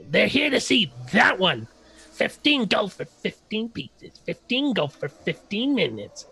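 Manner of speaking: high, pushy street hawker voice; eager halfling hawker. Acted. Bavik hawks the visit to the party, high and pushy. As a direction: sell it hard, pitched well up. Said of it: "He says".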